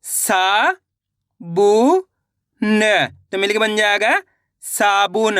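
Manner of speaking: सा बू न said one by one, then साबुन; syllable by syllable, then blend into साबुन; sounding out. Shown as a demonstration. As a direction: demonstration of blending सा, बू, न. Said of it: "चलिए देखिए".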